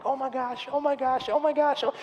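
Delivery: high, building "oh my gosh" chant; squeaky-high retold anticipation; mock breathless suspense. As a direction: relive watching the shot, rising in volume. Retold suspense watching his shot.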